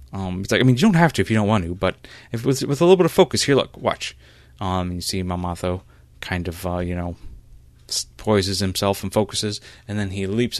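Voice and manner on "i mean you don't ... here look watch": quick, raised, louder encouragement; eager coaxing. Acted. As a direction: coax a nervous student, then offer to show. Mentor coaxing, offers to show.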